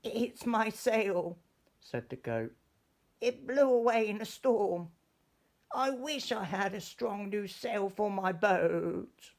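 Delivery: high, loud, upset goat voice; bleating goat. Acted. Goat on the boat wailing about its lost sail. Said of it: "a goat who was bleating loudly".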